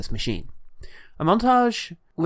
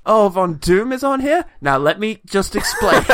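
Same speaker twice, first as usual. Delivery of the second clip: high, loud, put-on alarm breaking toward laughter; hammed-up high nervous voice; flustered explainer; 9 semitones higher, 7 dB louder than usual. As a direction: rush to excuse an awkward name on the list. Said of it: "Because it's like".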